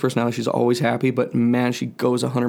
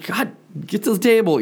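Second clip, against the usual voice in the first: raised-pitch frustrated order; exasperated parent; 8 semitones higher than usual. Retold or imitated. Dad ordering son to dinner, high exasperated voice. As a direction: act out running out of patience.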